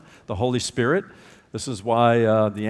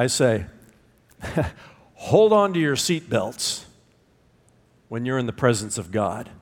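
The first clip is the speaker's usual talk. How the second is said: normal talking voice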